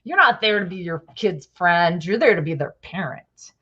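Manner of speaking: low, mock-stern strict parent voice; a hint of a preachy stern parent. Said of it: "all these people out there like".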